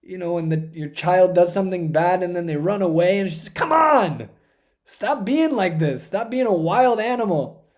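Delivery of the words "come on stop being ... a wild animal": raised-pitch exasperated telling-off; exasperated chastising. Acted. Parent whose child runs away, exasperated. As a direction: plead and scold at once. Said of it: "She chastises him".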